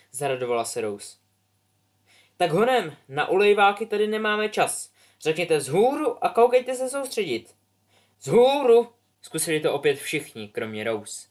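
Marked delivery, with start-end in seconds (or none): 2.4-7.5 much higher, loud impatient scold; scolding teacher. Acted. 8.2-8.9 very loud higher "Vzhůru!"; kids shouting. Acted. Class shouts again.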